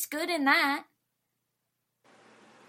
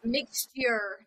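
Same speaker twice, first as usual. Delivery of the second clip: usual speaking voice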